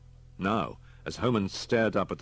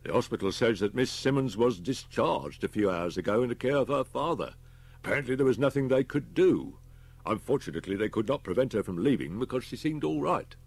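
lightly voiced detective, pitched higher; concerned detective reporting; 6 semitones higher, 5 dB louder than usual. A narrator's reading. Detective reports from the hospital, lightly raised voice. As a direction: report the news with mild concern.

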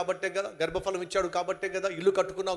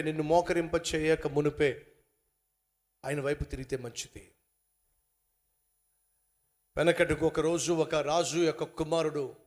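ordinary talk

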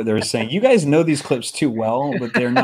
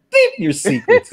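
slow, much higher laughing wizard line; mock Gandalf; slower than usual. Retelling the film edit to friends, laughing mock wizard. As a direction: squeal the line out through laughter. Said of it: "then he's like".

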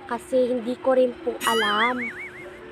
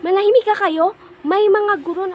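raised voice ordering the room to hush; 6 semitones higher, 6 dB louder than usual. Retold or imitated. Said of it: "So, sabi ko".

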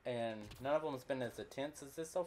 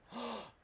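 startled gasp; 11 semitones higher, 11 dB quieter than usual. Real, not acted. Alien spotted, sharp intake.

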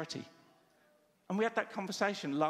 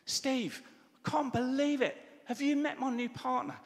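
high, louder excited call from afar; calling across the road; 9 semitones higher, 4 dB louder than usual. Retold or imitated. A friend across the road inviting Steve to dinner, calling out. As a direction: shout the invitation over traffic. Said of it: "this call from the other side of the road".

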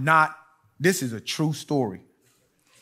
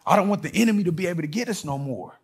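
ordinary talk, nothing marked

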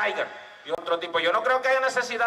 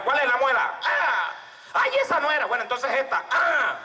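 mock dental patient; 7 semitones higher than usual. Dentist and patient pulling teeth.

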